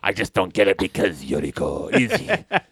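lightly voiced low tough guy, accented "Yuriko"; imitated Wolverine. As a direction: mimic him dropping low on "Yuriko". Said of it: "keep saying Yuriko that way".

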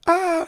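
short, high-pitched mock yell; sudden high "ah" of a pretend yell. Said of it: "It's not just a yell".

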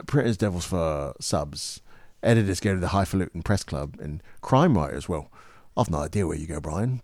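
rough-and-ready British character read; British accent. Shown as a demonstration. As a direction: deliver the script in a British accent. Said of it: "you sounded like Brad Pitt".

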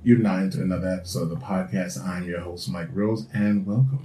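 low, soft sultry host voice; sexy acting voice. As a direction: purr it like a late-night host. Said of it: "the sexy acting voice".

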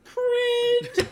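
very high, loud teasing cry; mock squeal. Mock verdict shouted at friends.

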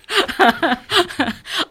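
a real, easy laugh at the host's joke; good-natured chuckle; amused laugh. Real, not acted. Joking about being a carpetbagger.

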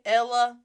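"ella" loud, with a lazy English uh; common mispronunciation. Retold or imitated. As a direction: imitate learners starting elefante as "ella". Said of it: "it's not ella".

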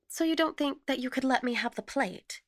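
brisk, high-pitched request; businesslike question, voice lifted. A narrator's reading. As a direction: ask briskly, pressing the point. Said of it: "she asked briskly".

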